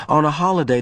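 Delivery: ordinary delivery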